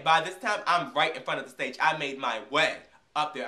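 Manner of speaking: usual speaking voice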